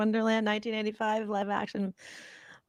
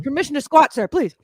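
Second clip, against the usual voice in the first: a soldier pleading with his general, a little louder; a playful private asking permission; mock soldier; 4 semitones higher, 5 dB louder than usual.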